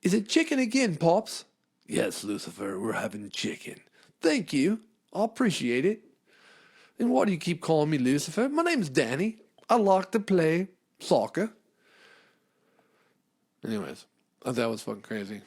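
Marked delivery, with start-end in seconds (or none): 0.0-1.5 put-on high, bright boy voice; child voice. Child voice in skit. 1.9-3.8 lower, softer dad; calm deadpan father. Pretend. Father voice in skit. 4.2-11.5 put-on high, bright boy voice; child voice. Child voice in skit.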